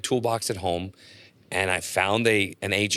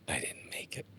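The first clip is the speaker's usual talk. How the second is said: whispered, crushed "I didn't make it"; despairing whisper; 7 semitones higher, 8 dB quieter than usual. Retold or imitated. Comedian driving home from the airport, whispering in defeat. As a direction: breathe it out defeated, almost voiceless.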